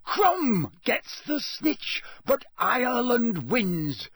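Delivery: slow, high stadium-announcer cry; Bagman announcing. A narrator's reading. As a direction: stretch it out for the whole stadium. Commentator announces match result.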